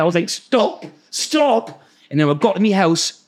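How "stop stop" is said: high-pitched mock cry to stop; acted-out alarm, pitched well up; retold frightened plea. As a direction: plead in a raised pitch, without shouting. Pleading as car nearly crashes.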